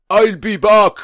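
mock a poor Arnie impression in cod German; bad German accent. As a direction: shout it in a crude German accent. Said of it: "sort of a generic bad German accent".

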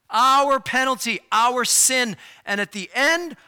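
loud, high pulpit climax; fervent preacher at the peak; full-force preaching. Acted. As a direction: drive it home to the whole church. Climax of the sermon.

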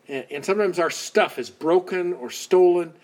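normal talking voice

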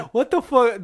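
normal talking voice

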